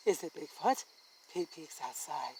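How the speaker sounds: ordinary delivery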